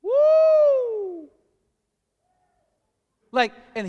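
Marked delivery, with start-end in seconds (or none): 0.0-1.3 high, loud celebratory woo; preacher whoops with joy; joyful whoop. Real, not acted. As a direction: let out a praise whoop.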